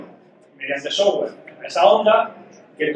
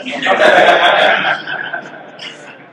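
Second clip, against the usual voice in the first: real laugh; 7 dB louder than usual. Real, not acted. Laughs at picky listeners.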